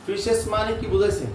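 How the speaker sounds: normal talking voice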